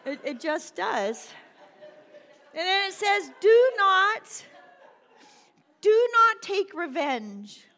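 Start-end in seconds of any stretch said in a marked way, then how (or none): 3.4-7.6 "Do not" drawn out, loud and high; emphatic command. Acted. Preacher repeating the verse against revenge, loudly emphatic. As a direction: punch "not" loudly, slowly, high-pitched.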